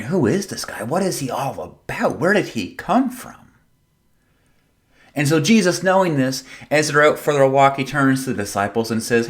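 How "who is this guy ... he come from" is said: lightly acted puzzled questions, pitch swooping; wondering. Preacher voices people talking about Jesus, wondering. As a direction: voice the curious crowd, mildly baffled. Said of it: "They start wondering".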